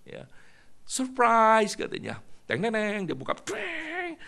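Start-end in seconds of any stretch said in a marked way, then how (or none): 0.9-1.7 put-on a high, loud child's surprise cry; excited shout. 2.5-4.1 high sung ta-da fanfare; mock fanfare. Mimicked fanfare sound.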